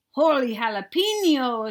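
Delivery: loud surprised yelp; exclaiming. Acted. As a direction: blurt it out in shock. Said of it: "exclaimed Skippy John Jones".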